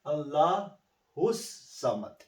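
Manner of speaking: "Allah who's Samad" slowly, the S held long; words joined by S. Shown as a demonstration. Teacher combining the words, slow "Allah who's Samad" with held S. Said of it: "So when you combine this".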